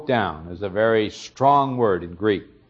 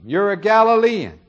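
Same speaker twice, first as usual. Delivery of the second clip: much higher and louder gotcha; triumphant accusation; 10 semitones higher, 5 dB louder than usual. Retold or imitated. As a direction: nail him, you've caught him out. Bystanders unmask Peter by accent.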